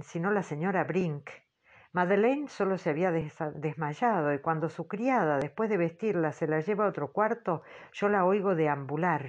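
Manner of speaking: ordinary delivery, nothing marked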